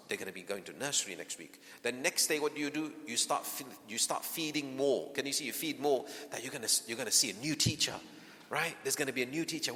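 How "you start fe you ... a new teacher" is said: ordinary talk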